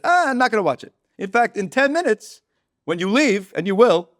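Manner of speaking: high, loud dismissive refusal; mock exasperation. Larry shuts down the fan's request, exasperated. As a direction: cut in with comic irritation.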